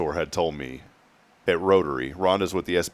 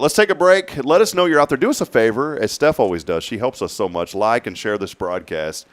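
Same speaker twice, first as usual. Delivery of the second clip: loud, upbeat radio announcer; big energetic sign-off to break; radio host voice; 5 semitones higher, 5 dB louder than usual. Acted.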